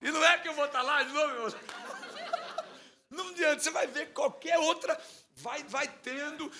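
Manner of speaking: real laughing aside; laughing while talking. Real, not acted. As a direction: talk through the giggles. Still laughing at the joke.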